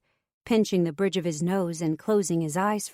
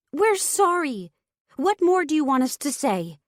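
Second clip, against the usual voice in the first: desperate yell; 9 semitones higher, 5 dB louder than usual. A narrator's reading. Sister yelling back at her furious brother, high desperate yell. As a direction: shout it out, pleading and fed up. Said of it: "I yelled".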